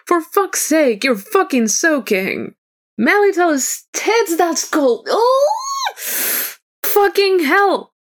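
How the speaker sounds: high, shrieking squawk of protest; outraged squalling. A narrator's reading. Bard squalling at soaking cold alphas hugging him, high-pitched squall. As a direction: squall it like a soaked cat. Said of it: "squalls like a wet cat".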